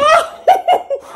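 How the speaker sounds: éclat de rire très haut; rire aigu ravi. Real, not acted. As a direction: rire de pure joie devant le cadeau. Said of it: "tellement c'est beau". (rire suraigu et émerveillé)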